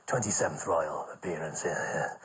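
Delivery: put-on low, hushed old comic soothing a nervous lad; a low half-whisper, calming him down; low whispered reassurance from an old pro; Les Dawson reassuring. Les voiced.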